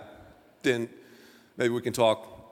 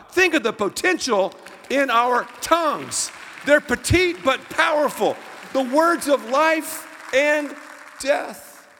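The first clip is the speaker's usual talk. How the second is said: higher, louder slow preaching; fervent pulpit delivery; preaching at full force; 7 semitones higher, 5 dB louder, slower than usual. Acted. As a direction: build the point to its peak. Preaching climax.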